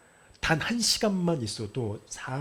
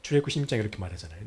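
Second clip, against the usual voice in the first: ordinary talk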